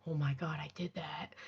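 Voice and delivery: very soft, low, breath-only amazement; awed whisper. Retold or imitated. Voice actress seeing the story come together, awed whisper. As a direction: whisper it to yourself in wonder. Acts out hushed awe at result.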